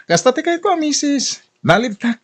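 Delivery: high, fast laughing flattery. Acted. Agent laughing while flattering the wife, high and quick. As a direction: chuckle through the compliment.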